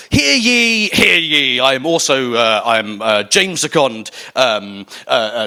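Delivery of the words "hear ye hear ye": drawn-out crier's proclamation; mock town crier. Speaker opening his talk to the audience, mock town crier. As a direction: call it out slowly like a herald.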